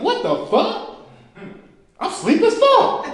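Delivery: slow, high laughing disbelief; startled realization. Retold or imitated. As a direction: freak out at the sixth finger. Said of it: "You like".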